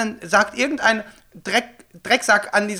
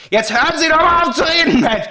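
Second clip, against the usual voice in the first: raised, exasperated yell; retold yelling; 8 semitones higher, 4 dB louder than usual. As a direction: snap at her to stop talking. (genervt anschreien)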